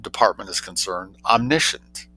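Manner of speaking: usual speaking voice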